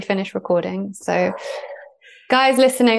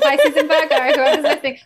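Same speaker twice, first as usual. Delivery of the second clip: higher, louder words through real laughter; laughing; 7 semitones higher, 4 dB louder than usual. Real, not acted. Host jokes to listeners about going off on a tangent, laughing. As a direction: talk while laughing.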